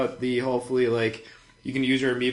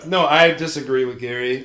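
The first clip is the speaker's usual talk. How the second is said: ordinary delivery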